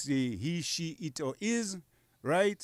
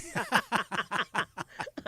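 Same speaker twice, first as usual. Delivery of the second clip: high, giggling laugh; real amused giggle; 9 semitones higher than usual. Real, not acted. Laughing at the crying story.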